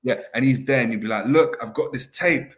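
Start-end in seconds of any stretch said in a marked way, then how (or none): 1.3-2.5 slow, raised mock German accent as Klopp; hammed-up German accent, pitched up and drawn out. Klopp pitching tape on a shopping channel, mock German accent. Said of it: "in that German accent".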